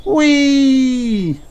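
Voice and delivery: high, loud squeal of delight; long high "whee" in mid-jump; gleeful whee. A narrator's reading. Kylie jumps high into the air, squealing happily.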